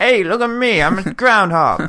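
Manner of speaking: high-pitched wiseguy impression; Joe Pesci. Imitating a groundhog voiced by a film actor, comic impression. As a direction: start high and punchy, then stretch the last word. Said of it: "That's Joe Pesci right there".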